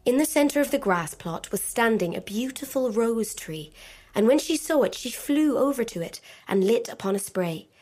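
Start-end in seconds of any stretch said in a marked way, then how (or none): none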